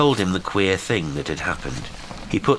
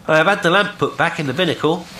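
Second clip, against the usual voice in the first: high, raised, quick call; calling assurance; 11 semitones higher, 5 dB louder, faster than usual. A narrator's reading. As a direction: announce it across the water. Will calls across water.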